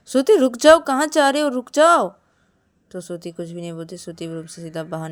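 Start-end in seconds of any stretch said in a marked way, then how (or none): 0.1-2.1 high, loud urgent call; calling after someone. Acted. Shreya calls after Shruti leaving the room, loud and urgent. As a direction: call out to stop someone walking away. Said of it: "पीछे भी बुलाने लगती है".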